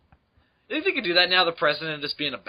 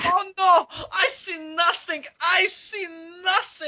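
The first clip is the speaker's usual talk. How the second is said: high, crying mock Schultz; Sergeant Schultz voice. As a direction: wail it like a panicked guard. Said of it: "recurring characters".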